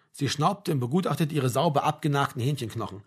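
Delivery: usual speaking voice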